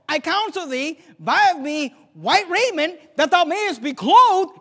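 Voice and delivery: high, loud, slowly stretched scripture; forceful preaching. Acted. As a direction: drive the verse home phrase by phrase. Repeats the verse at full force.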